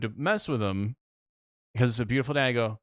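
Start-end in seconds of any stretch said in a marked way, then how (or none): none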